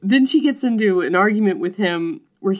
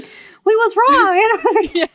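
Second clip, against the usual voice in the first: much higher, louder whiny puppy voice; confused puppy; 7 dB louder than usual. Retold or imitated. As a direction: play a baffled eager puppy. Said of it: "he's just like a puppy".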